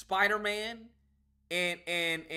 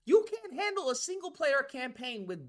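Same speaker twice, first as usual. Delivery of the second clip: mock rant; 7 semitones higher than usual. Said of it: "But I'm like".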